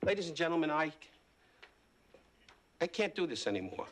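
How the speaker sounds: halting, hurt, with long pauses; wounded. Acted. Host walks off his show after insult, wounded and low. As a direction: sink low as if too hurt to go on.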